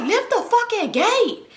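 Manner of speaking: high, slow, drawn-out bossy demand; exasperated mock order. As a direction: stretch each word like a fed-up homeowner. Guy repeats order, now fed up.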